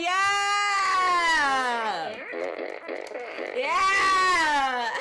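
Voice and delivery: long, drawn-out shouted cheer; hyped shout. Real, not acted. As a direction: yell it out and hold the note. Hyped shouting at anime fan.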